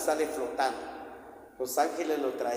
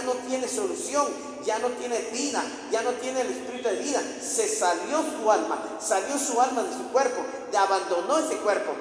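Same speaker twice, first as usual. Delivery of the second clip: raised, high-pitched preaching fervour; impassioned preaching; 7 semitones higher than usual. Real, not acted. Preacher on Jesus raising the dead, fervent proclamation. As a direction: drive it home to the congregation.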